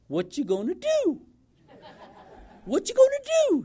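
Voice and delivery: high-pitched comic mimicry, rising sharply; exaggerated high voice, playfully mocking; battalion chief imitation. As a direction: imitate the old chief's squeaky catchphrase. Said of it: "That's the way he said it".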